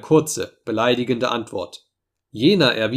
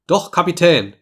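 higher, louder objection; firm contradiction; 6 semitones higher, 5 dB louder than usual. A narrator's reading. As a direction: cut in to contradict your captain. Officer contradicts his captain. (entschiedener, lauter Widerspruch)

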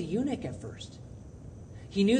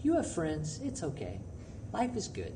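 softer, gentle reassurance; soothing comfort; 8 dB quieter than usual. Retold or imitated. Pastor imagines soothing a sobbing student, soft and gentle. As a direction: coax the crying kid back inside. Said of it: "and said".

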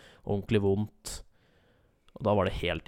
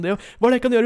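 very high, loud helpless asking; flustered helper yelling; frantic question; 14 semitones higher, 10 dB louder than usual. Retold or imitated. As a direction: ask in a panic. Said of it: "Og jeg bare sånn".

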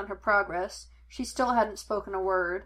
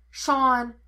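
loud, sharp name-call; curt fatherly reprimand; snapping; 3 semitones higher, 7 dB louder than usual. A narrator's reading. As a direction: snap the name to stop him. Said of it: "Henry snapped".

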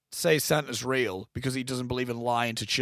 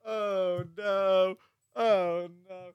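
laughing; 4 semitones higher, much slower than usual. Real, not acted. Laughs after friend's outburst.

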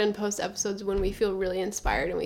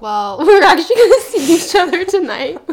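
laughing; 8 semitones higher, 15 dB louder than usual. Retold or imitated. Retold line to each other.

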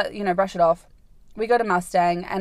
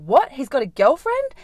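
shocked question; 6 semitones higher, 5 dB louder than usual. Retold or imitated. Shocked retold reaction.

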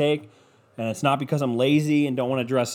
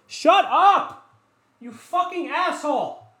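yelling at dog; much slower than usual. Real, not acted. Owner screaming at his barking dog, high furious shout. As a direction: blast the barking dog into silence. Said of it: "I can talk to him like that".

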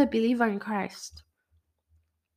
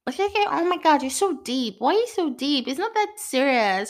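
higher mocking friend voice; lightly voiced mocking friend; mimicking friends; 7 semitones higher, 5 dB louder than usual. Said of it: "I'm mimicking a particular person's voice".

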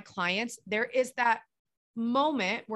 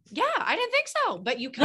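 teasing; 7 semitones higher, 3 dB louder than usual. Retold or imitated. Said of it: "And I'm like".

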